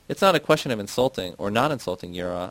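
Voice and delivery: ordinary delivery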